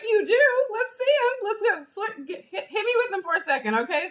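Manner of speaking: put-on high, playful baby-talk excitement; gushing at a baby. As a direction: coo over a little one showing off.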